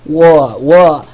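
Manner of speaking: "War" said loud, drawn out; says war. Shown as a demonstration. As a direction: stress "war" twice, loudly. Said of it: "Because I say war".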